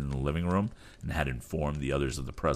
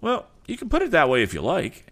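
high, loud mocking voice; rival henchman; 14 semitones higher, 7 dB louder than usual. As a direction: brush him off mockingly. Another rival man answers.

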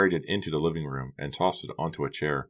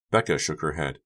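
ordinary delivery, nothing marked

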